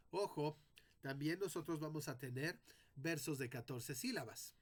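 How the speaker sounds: ordinary talk, nothing marked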